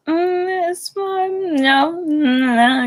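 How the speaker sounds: high, hesitant dodging voice; flustered self. Retold or imitated. Narrator imitates herself dodging her aunt's question, hesitant and high. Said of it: "I was like".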